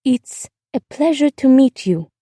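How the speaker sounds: put-on careful, formal politeness from a child; girl feeling grown up. A narrator's reading. Girl politely greeting her glamorous neighbor, trying to sound grown up. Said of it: "feeling suddenly grown up".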